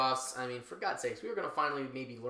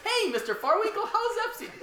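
high mocking voice; 6 dB louder than usual. Acting guard colleague.

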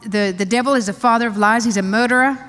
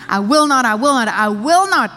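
exultant repetition; 3 semitones higher, 5 dB louder than usual. Real, not acted. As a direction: ring it out like a victory cry. Preacher exults over repeated promise.

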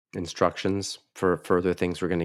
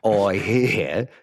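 put-on Australian tourist talk; hammed-up Sydney local; mock Sydney accent; 3 semitones higher, 4 dB louder than usual. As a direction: join in with a broad Australian accent. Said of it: "this is my Sydney accent".

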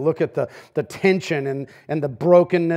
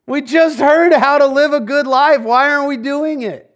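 much higher, loud, pleading cry; exasperated young believer; 11 semitones higher, 7 dB louder than usual. Retold or imitated. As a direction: plead with the crowd to wake up. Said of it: "And he's like".

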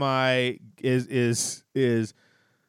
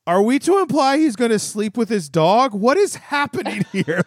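incredulous; 10 semitones higher, 6 dB louder than usual. Retold or imitated. Incredulous reaction to beer commercial.

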